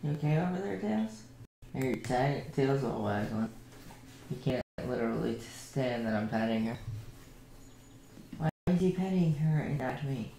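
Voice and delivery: tender murmur to a sleepy pet. Real, not acted. Streamer softly praising her tired dog, tender coo. Soft talk to dogs.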